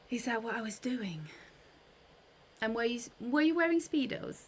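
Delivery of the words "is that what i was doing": soft unvoiced whisper; hushed teasing whisper; whispered aside. Real, not acted. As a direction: breathe it as a private joke. Teasing aside about a dream.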